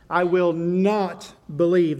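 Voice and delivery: slow I will not believe, weight on not; plain; stress on not. Shown as a demonstration. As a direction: press the word not down hard. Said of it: "The emphasis there is on the word "not".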